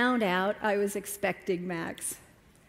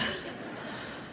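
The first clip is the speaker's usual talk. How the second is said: soft rueful laugh; 11 dB quieter than usual. Real, not acted. Laugh after disastrous outing.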